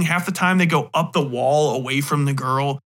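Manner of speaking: ordinary delivery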